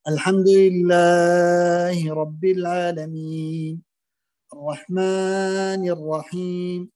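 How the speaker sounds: الحمد لله رب العالمين, الرحمن الرحيم recited very slowly with stretched vowels; slow chanted recitation, over-lengthened; overlong madd. Shown as a demonstration. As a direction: stretch every long vowel too far. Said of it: "في المد الطبيعي يمد".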